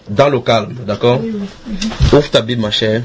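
ordinary talk, nothing marked

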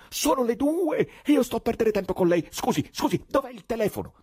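high, loud, urgent voice; frantic poet. Acted. Ivan demanding the telephone, frantic. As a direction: exclaim in a high, frantic rush. Ivan panics about the time.

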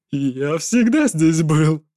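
slow, loud, cackling words; a madman cackling through it; mad laughter. A narrator's reading. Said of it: "продолжая хохотать". (сквозь безумный хохот)

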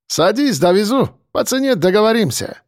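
smiling driver with a hint of a Caucasian accent; light Caucasian accent. A narrator's reading. Driver greets arriving guests, light Caucasian accent. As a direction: ask it lightly, Caucasian lilt. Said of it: "с лёгким кавказским акцентом".

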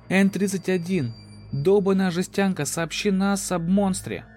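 high, loud, frantic yelling; desperate shout. Acted. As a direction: scream at the broken machine. Desperate captain yells at AI.

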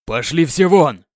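loud, slow royal roar; thundering command. Acted. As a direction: roar at everyone to leave. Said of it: "прогремел король".

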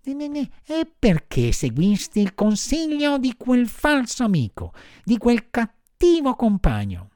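high-pitched marmot scolding; high little animal reproach; reproachful marmot. A narrator's reading. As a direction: question the puppet sternly, voice way up.

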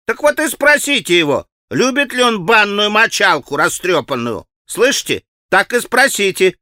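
high, lively schoolboy mischief; teasing boy. A narrator's reading. Boy sending Alyosha with a taunting question, teasing. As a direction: egg him on with boyish relish.

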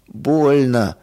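plain reading voice

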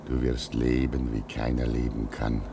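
put-on old man blessing the young man's sacrifice; solemn blessing.

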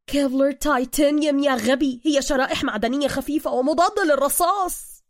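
loud, high irate lecturing; exasperated correction. Acted. Bruce correcting Suka's blunder, loudly exasperated. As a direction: snap the correction at a fool.

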